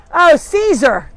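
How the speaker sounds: high, loud call of recognition; loud exclamation. Real, not acted. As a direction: exclaim on spotting the noisy culprit. Spots the dog that is yelling.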